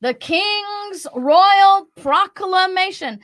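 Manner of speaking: high, slow grand announcement; town crier. Pretend. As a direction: announce it like a royal herald. Mocking royal proclamations.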